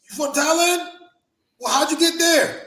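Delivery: high-pitched mock astonishment; imitated incredulity. Backstage stranger questions a wrestler about Thailand, incredulous. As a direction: act out a baffled questioner, voice leaping up. Mimics backstage racist's disbelief.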